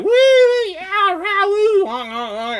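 high, loud whooping and honking; cheering screams, imitated. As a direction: whoop like passing drivers yelling and honking. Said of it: "but screaming like".

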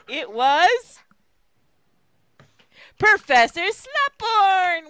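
loud, high, slow announcement; loud slow dramatic reveal. Acted. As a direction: unveil the name like a plot twist. Dramatic reveal in fic reading.

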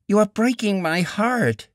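high, mildly tearful woman's voice; a hint of sobbing, heartbroken. A narrator's reading. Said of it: "she sobbed".

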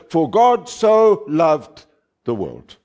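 "so" drawn out slowly in "God so loved"; stress on SO. Shown as a demonstration. Preacher quoting how people cite the verse, stressing SO. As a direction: quote it with a heavy, lingering SO. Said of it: "emphasis is placed on the word so".

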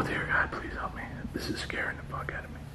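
hushed, scared whispered prayer; frightened whisper. Real, not acted. Alone in the dark, praying under his breath in a scared whisper. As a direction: breathe the words, terrified of being heard.

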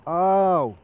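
loud high pained yelp; hammed-up cry of pain; mock scream. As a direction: yell ow like being hit. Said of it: "and scream".

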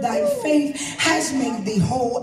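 normal talking voice

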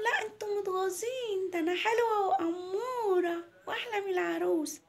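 put-on high, whiny child voice; boasting little girl. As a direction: brag back at the kids like a small girl. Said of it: "قالت لهم". (صوت طفلة بتتباهى بدلع)

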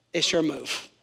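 usual speaking voice

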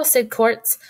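ordinary delivery

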